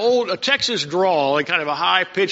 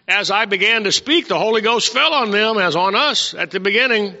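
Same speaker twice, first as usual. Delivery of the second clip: raised voice, reading the verse out to the church; scripture reading; 3 semitones higher, 3 dB louder than usual. Acted. Preacher reads the verse aloud to his congregation, in a raised voice. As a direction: proclaim the verse, lifting it up.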